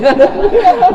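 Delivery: a quick high burst of laughter. Real, not acted. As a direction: laughs while telling his jinn story, a high laugh. Laughs at story.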